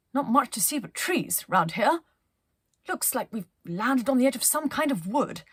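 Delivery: a cheery expedition leader sizing things up; Penelope's voice. Acted. Penelope tells her crew what she sees, brisk and bright. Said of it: "said Penelope".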